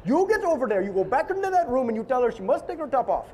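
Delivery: mock Indian accent barking orders; pitiful Indian accent. Indian producer ordering Eli to go back, pitiful Indian accent. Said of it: "this is my pitiful Indian accent".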